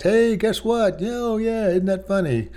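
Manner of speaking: high, light, chirpy offhand voice; breezy matter of fact. Retold or imitated. Father claiming he sounded casual to his son, chirpy. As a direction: sound like it's just a friendly aside. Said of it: "To me, I'm just going matter of fact".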